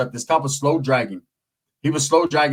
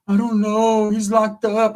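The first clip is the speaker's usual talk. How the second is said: higher mock-tearful voice; imitated crying; 6 semitones higher, slower than usual. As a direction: imitate a woman wailing on the phone. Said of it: "she starts crying".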